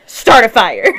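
a hint of a devil egging someone on; shoulder devil. Shoulder devil tempting the player, lightly acted loud urging. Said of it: "the other one is saying".

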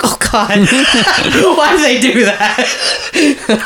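high laughing groan, slowed down; laughing disbelief. Retold or imitated. Telling her co-host how the ending got her, laughing. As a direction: laugh through it like the scene got you. Recalling crying at the movie.

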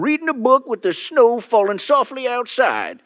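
put-on high-pitched old prospector coot; old hermit voice. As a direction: play a cranky old mountain hermit, high and stammering. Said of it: "Been working on that hermit voice".

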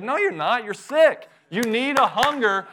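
emphatic rebuke. Real, not acted. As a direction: snap the excuse back. Preacher answers a mock excuse.

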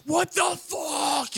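high, slow, stretched-out yell; retold scream. Retelling his meltdown to friends, a slow high scream. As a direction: let it echo through the garage. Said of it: "I'm literally screaming".

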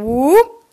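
short whoop sliding upward; rising whoop. Retold or imitated. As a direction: imitate a chair tipping with a whoop. Imitates kids tipping their chairs.

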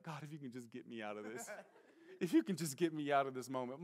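quiet, pleading prayer; desperate prayer. Retold or imitated. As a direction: beg God under your breath. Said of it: "I'm saying a prayer like".

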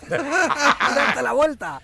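high, drawn-out jeering yell; mocking shout. Jeering the hero for turning away, mock shout. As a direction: stretch out the punchline at full volume.

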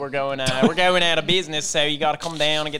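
put-on Delco accent, lively sales pitch; exaggerated Delco accent, pushing the deal; Delco car-dealer ad voice. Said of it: "talking, like".

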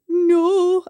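put-on upset child. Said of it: "he was like".

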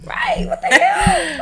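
high squealing laugh-talk; cracking up while yelling; laughing shriek. Real, not acted. Laughing shout with friend.

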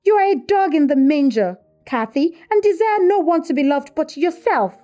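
pitched high and loud; heated accusation. A narrator's reading. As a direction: hurl the accusation in an acted quarrel. Heated quarrel between sisters-in-law.

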